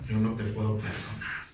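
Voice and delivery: low, cold brush-off; mock the proud refusal, dropped low; smug refusal. Preacher mocking someone refusing to forgive, low and cold.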